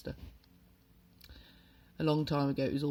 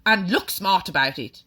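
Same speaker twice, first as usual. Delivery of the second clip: loud, snapped command; shouted order; 3 semitones higher, 8 dB louder than usual. A narrator's reading. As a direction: bark the rest of the order. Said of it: "the Trunchbull shouted".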